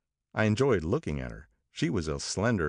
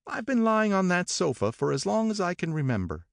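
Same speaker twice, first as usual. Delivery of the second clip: complaining; 11 semitones higher than usual. A narrator's reading. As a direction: complain lazily, pitched up. Miss Baker voiced.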